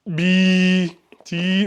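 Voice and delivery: ordinary talk, nothing marked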